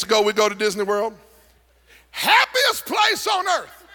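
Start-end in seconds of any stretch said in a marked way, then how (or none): 2.1-3.9 mock slogan. Mocking Disney slogan.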